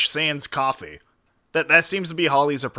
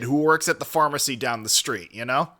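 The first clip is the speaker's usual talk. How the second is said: ordinary talk, nothing marked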